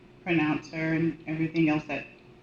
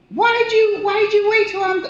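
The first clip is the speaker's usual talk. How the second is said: high, loud grieving plea; a much higher, louder voice for the grieving daughter; anguished cry; 14 semitones higher, 7 dB louder than usual. Retold or imitated. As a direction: wail at her dead mother. Grieving mother cries out.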